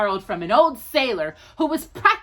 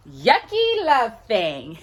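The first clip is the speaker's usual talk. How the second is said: slow, giggling through the words; laughing; slower than usual. Real, not acted. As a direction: laugh as you say it slowly. Laughing while reading.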